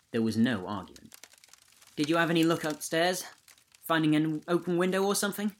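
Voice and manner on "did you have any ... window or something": optimistic. A narrator's reading. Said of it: "she asked optimistically".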